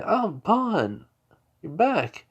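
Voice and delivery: high, swooping acted welcome; delighted greeting. Parents welcome their son back, high delighted greeting. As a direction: greet him in a high, pleased voice.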